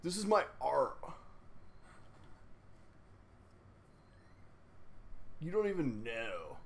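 a hint of a sulky Simpsons teen; Snake teen voice. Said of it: "my teenager voice is Snake from The Simpsons".

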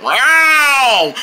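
drawn-out warning yowl; unfriendly cat meow. Acted. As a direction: yowl to scare visitors off. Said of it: "Uh-oh, not friendly".

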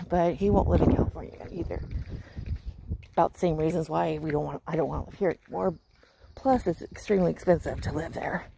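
ordinary talk

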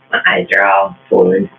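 a comic low groan of a voice; deep silly voice. Pretend. Complaining about swollen eyes in a deep silly voice. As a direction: drop way down low for a goofy moan.

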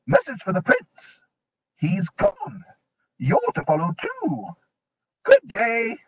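very high hooting owl voice; a brisk owl messenger. A narrator's reading. Owl delivers message.

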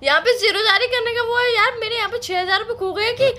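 high, tearful complaint; hammed-up weepy protest; whiny half-cry. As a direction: whimper that the money is gone. Money lost, partner making rhymes.

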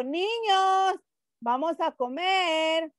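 singsong call on NIÑOS, COMER held long and high; NIÑOS first, as a call. Shown as a demonstration. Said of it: "O yo digo".

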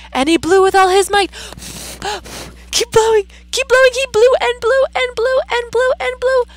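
high, loud, fast narration; excited climax. A narrator's reading. Teacher to class, urgent excited storytelling. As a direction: ramp up the excitement.

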